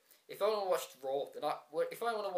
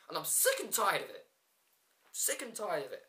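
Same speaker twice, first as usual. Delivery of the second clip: fed up; 4 semitones higher than usual. Real, not acted. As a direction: vent real frustration at the booking. Fed-up rant about Brogue Kick.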